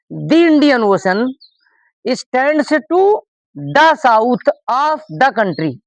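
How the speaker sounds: slow, word-by-word model sentence; slow dictation. Shown as a demonstration. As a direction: read each word out slowly for the class. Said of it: "इस Wavell को देख कर करेंगे".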